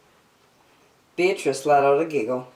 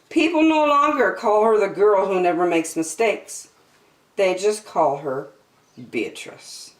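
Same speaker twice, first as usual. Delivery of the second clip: ordinary delivery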